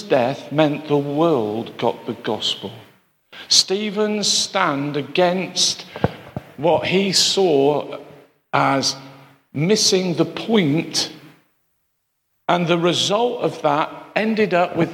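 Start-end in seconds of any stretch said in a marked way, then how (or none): 3.5-11.1 every word slowed and set apart; slow, weighty emphasis. Acted. Preacher driving home Stephen's stand, very slow. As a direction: let each phrase land slowly. Preacher slows for key point.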